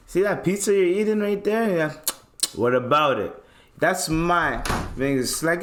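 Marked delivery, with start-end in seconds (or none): 0.1-3.4 mock tough guy. Voicing the pizza eater.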